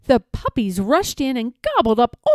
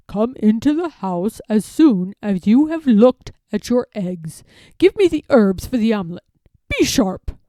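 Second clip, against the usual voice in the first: curt, hurried fox gentleman, partly louder; abrupt fox; 5 dB louder than usual. A narrator's reading. Fox ordering Jemima to hurry with the herbs, abrupt. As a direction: drop the politeness and rush her. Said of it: "He was rather abrupt".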